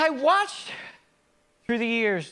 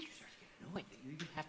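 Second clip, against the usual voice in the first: whisper; 2 semitones lower, 21 dB quieter, much faster than usual. Acted. Unvoiced aside mid rant.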